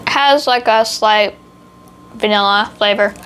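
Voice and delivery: bits of biscuit in mouth; chewing while talking. Real, not acted. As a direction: mouth partly full. Seen 4: Holds small star piece at lips, nibbling while speaking.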